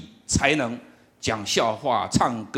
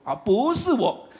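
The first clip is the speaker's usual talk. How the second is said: protesting; 5 semitones higher, much slower than usual. Retold or imitated. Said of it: "我说".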